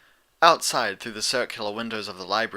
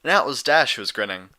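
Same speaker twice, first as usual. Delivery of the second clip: usual speaking voice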